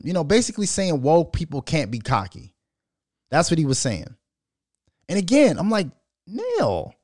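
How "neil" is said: name stretched high, then falling; protesting disbelief. Retold or imitated. Host objecting to his friend's post, in drawn-out disbelief.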